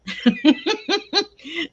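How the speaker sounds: bright burst of laughter. Real, not acted. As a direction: laugh out loud. Laughing at viewer comment.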